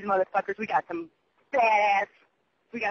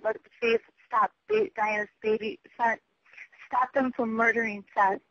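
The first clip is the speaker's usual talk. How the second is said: ordinary delivery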